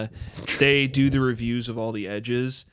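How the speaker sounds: ordinary talk, nothing marked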